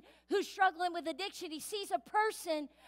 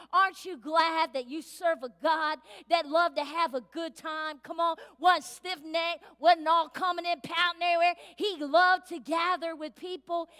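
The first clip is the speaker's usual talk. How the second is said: louder, building sermon delivery; preaching; 5 dB louder than usual. Acted. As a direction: drive it home to the congregation. Preaching with rising force.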